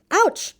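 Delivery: sharp high yelp of pain; cry of pain. A narrator's reading. Guardian crying out when the horse stamps his toes. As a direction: yelp as the toe gets stomped. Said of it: "cried the man".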